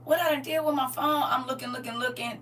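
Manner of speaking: high, loud mock panic; exasperated, high-pitched storytelling; retold frantic searching. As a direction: act out hunting for a lost phone. Frantic retold search for lost phone.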